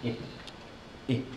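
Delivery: "I" as a brief ih, each on its own; short i sound. Shown as a demonstration. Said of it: "Short sound".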